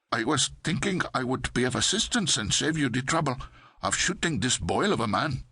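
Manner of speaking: put-on pleased, sing-song Pakistani accent; smiling Pakistani accent. A narrator's reading. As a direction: offer it with a smile in a Pakistani accent. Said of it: "the clerk smiled".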